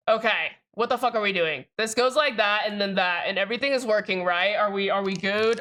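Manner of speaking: normal talking voice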